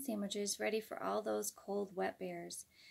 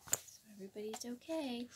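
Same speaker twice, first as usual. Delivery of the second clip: whispering; 5 dB quieter than usual. Real, not acted. Aside to listeners mid-story.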